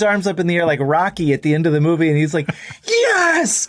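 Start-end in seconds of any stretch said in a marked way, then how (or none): 2.9-3.7 triumphant yes. Retold or imitated. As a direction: cheer it like Rocky at the end of the movie. Guitarist's triumphant yell after song.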